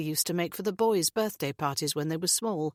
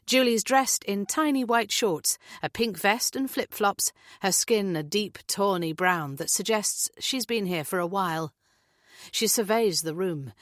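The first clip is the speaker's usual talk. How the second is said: usual speaking voice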